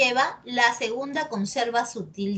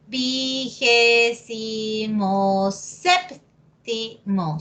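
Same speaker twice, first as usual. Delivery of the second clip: slow demo; much slower than usual. Shown as a demonstration. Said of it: "solo se conserva esta tilde".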